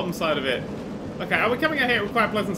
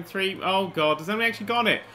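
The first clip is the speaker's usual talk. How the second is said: ordinary talk, nothing marked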